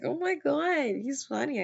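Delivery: a touch of giddy delight; smitten. Retold or imitated. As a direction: gush over a funny boy. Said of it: "I'm like".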